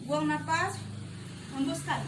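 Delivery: loud, high call to breathe out; loud command. Shown as a demonstration. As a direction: push the exhale call out loud. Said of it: "coaching exhale while standing".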